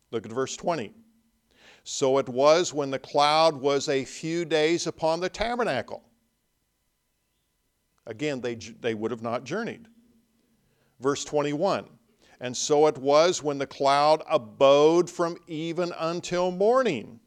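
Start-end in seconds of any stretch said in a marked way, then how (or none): 1.8-6.0 ringing, emphatic reading of the verse; scripture reading. Acted. Preacher reading verse nineteen aloud, emphatic reading. 12.4-17.2 louder ringing scripture. Acted. Preacher proclaiming verse twenty-one, loud and ringing.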